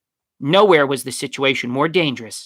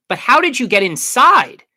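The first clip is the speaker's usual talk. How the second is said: high, loud insistent question; raised, pointed interrogation; persistent; 11 semitones higher, 8 dB louder than usual. A narrator's reading. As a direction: press the point, demanding an answer. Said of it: "Juliana persisted".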